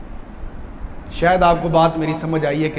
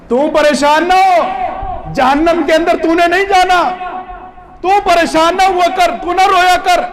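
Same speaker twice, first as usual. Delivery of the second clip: consoling shout; 11 semitones higher, 6 dB louder than usual. Acted. Imam consoling the crying child loudly.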